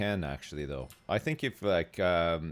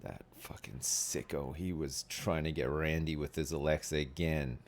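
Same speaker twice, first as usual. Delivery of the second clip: muttering neighbor; 6 semitones lower, 7 dB quieter than usual. Pretend. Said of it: "they're like".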